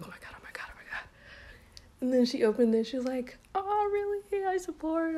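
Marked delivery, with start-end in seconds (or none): 0.0-1.1 hushed, near-whispered panic imitation; barely voiced anxious self-talk; retold nervous muttering. Retelling her nervous wait for reaction. 3.6-5.1 very high-pitched retold delight; high, sing-out mock surprise; imitated supportive reaction. Acting out stepsister's supportive reaction.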